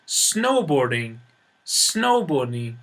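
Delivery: Snowboarding slow, snow stressed; stress on first word. Shown as a demonstration. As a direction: stretch it out, weight on snow. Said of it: "Stress is on the first word".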